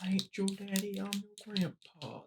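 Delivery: high, soft put-on meme voice. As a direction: quote the meme in a high hushed voice. Quoting meme in a voice.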